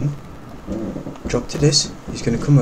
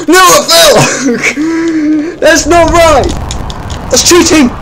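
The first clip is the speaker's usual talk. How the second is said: very high, loud, slow whining cry; half-laughing anguished wail; mock wail; 5 dB louder, slower than usual. Real, not acted. Falls again, wailing.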